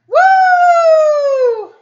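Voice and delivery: long, high, loud "woo"; celebratory whoop. Real, not acted. Co-host standing in as noisemaker for Timo's donation, loud whoop. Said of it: "any kind of noisemaker".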